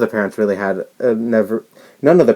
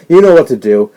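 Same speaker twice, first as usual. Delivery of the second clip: loud, raised retort of an impatient teacher; scolding teacher; 4 semitones higher, 12 dB louder than usual. Retold or imitated. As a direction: mimic a teacher telling a child off loudly. Teacher scolding a child, retold.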